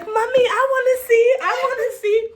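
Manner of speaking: put-on high, loud child's pleading; little daughter begging. Mom imitating her daughter begging to see, high. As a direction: beg like an eager toddler. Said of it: "Majesty's like".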